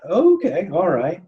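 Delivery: high-pitched, retold hesitant agreement; taken-aback acceptance. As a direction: go along with it, pitch jumping up. Retells accepting supervisor's offer of help.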